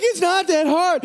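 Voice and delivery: put-on high, slowed child's echo; child echoing dad. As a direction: chant it back as the child. Child echoes dad's holler.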